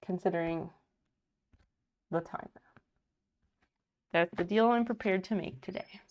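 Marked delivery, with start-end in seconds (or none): none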